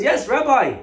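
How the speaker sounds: high, loud outburst; agitated protest. Acted. Jailed shopkeeper answers rabbi, loudly agitated. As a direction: burst in, pitch jumping up.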